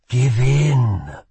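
an otherworldly voice urging surrender; firm, commanding voice from within; voice in his head. A narrator's reading. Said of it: "said a voice in his head".

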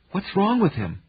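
plain reading voice

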